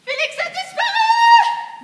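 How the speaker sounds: high, slow, loud cry; alarmed shout. Acted.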